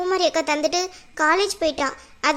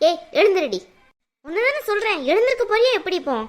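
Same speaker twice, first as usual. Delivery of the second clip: higher and fast, furious command; angry order; 4 semitones higher than usual. Acted. Malar to the girl wearing her dress, angry and fast. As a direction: bark at her to get up now.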